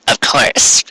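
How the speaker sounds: whispered dry aside; a muttered eye-roll to the listener; sarcastic aside. Acted. Narrator commenting on the easy defeat: dry whispered aside.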